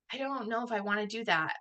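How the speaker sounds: subdued, unsure mumbled thought, acted out; hesitant inner thought. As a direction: voice a private doubt softly. Inner doubt after eager yes.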